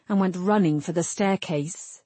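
usual speaking voice

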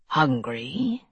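low, drawn-out creature voice; Maokai voice. A narrator's reading. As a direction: ask it low, with a slow rise. Menacing creature speaks in story.

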